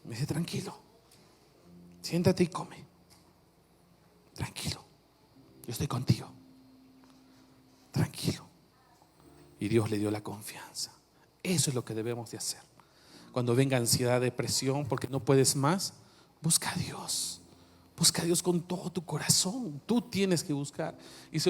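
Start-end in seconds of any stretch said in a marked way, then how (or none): 0.3-8.4 soft, hushed reassurance dropping to a whisper; calm him gently like a frightened child; tender comfort. Retold or imitated. Angel soothing Elijah, soft and whispered. 16.4-19.8 hushed urgent whisper; whispered plea. Real, not acted. Urging the congregation softly.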